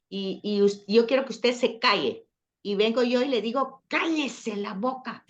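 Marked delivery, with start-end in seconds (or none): 3.9-5.3 drawn-out, higher-pitched order to shut up; mock scolding, stretched out; demonstrated rude command. Shown as a demonstration. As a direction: slowly act out a rude order. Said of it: "así".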